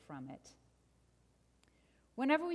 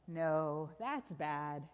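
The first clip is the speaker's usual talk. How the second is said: low, heavy contradiction; ominous correction; 5 semitones lower, much slower than usual. Acted. Storyteller overturns good news, low and grave. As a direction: sink deep on the bad news.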